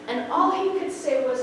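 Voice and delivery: ordinary talk, nothing marked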